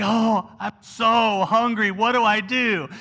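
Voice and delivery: slow, drawn-out mock despair; melodramatic hungry teenager. Imitating a teenager at home who got hungry, overdramatic whining. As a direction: stretch it out like a helpless, starving teen. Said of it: "suddenly the world's falling apart".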